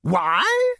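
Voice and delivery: high, astonished ghost; a ghost amazed at the question; astonished exclamation. A narrator's reading. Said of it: "exclaimed an astonished ghost".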